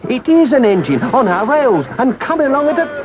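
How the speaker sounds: high, urgent engine driver's call. Acted. Engine driver warning of the chasing engine, calling out high. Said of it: "the engine driver called out".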